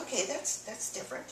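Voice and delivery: soft, breathed-out amazement; almost whisper it in disbelief; hushed wonder. Retold or imitated. Quietly noticing the pain easing.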